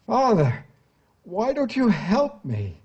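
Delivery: high-pitched cry of a desperate son; pleading cry. A narrator's reading. Dying son begs his father for help, high pleading cry. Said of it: "Garo cried".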